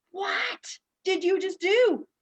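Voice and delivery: high, slow, astonished question; stunned disbelief. Retold or imitated. Imitating her own shocked face after the session, slow and high. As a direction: drag it out in wide-eyed shock. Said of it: "the look on my face was like".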